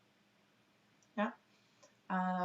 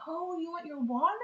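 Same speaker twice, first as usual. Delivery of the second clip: put-on much higher, sing-song voice for a toddler; high parent talk; 10 semitones higher than usual. Shown as a demonstration. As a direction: coo it to a baby reaching out. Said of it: "parent modeling to child".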